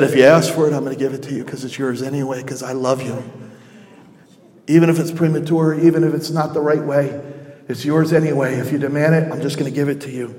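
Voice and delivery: low, soft and gentle; tender father. Acted. Father yielding to his son, soft and low. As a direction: give in lovingly, almost under the breath. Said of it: "He said".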